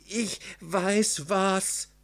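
high, slow, drawn-out cry of delight; enthusiastic exclamation. A narrator's reading. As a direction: call out a sudden bright idea. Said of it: "rief Fritzchen begeistert".

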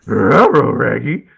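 loud cartoon dog talk; goofy cartoon dog voice; Scooby-Doo voice. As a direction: bark the words like Scooby-Doo. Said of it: "do it all in Scooby-Doo's voice".

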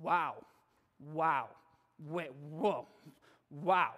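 low-pitched disbelief at foolishness; low, disapproving astonishment; appalled wow. Shown as a demonstration. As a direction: react to something insensitive, pitched well down. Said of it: "hear something incredibly insensitive and foolish".

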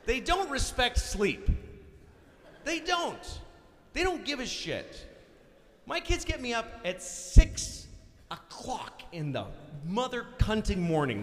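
high, slow, aggrieved complaint; worked-up comic indignation; resentful rant. Acted. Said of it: "The one thing I truly resent".

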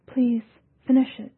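beg, close to tears; tearful plea. A narrator's reading.

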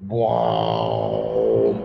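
deep ghostly voice saying wow; eerie otherworldly wow. Retold or imitated. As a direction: let a deep alien voice sound through you. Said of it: "there's this sound that happens to me and it goes".

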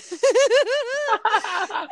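helpless high laughter; loud laugh. Real, not acted. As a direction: burst out laughing. Laughing at memory.

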